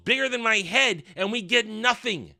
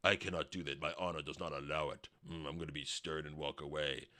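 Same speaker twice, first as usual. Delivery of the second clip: much lower, softer stern voice; mock stern hero; 14 dB quieter than usual. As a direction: play the noble stiff soldier. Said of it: "He goes".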